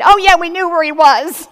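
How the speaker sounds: a hammed-up guilty cover story, loud and high; mock pretending parents. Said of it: "we can't pretend".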